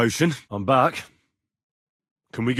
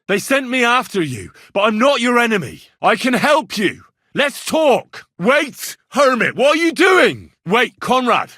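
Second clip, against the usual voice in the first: high, loud urgent calls; calling out; 11 semitones higher, 6 dB louder than usual. Acted. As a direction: shout after him so he stops. Calling after fleeing hermit.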